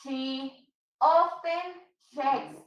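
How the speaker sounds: She often checks said slowly, each word spaced out; teacher modelling the sentence slowly; slow, deliberate model sentence. Shown as a demonstration. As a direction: spell it out slowly for the class so they hear every word. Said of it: "Check the difference".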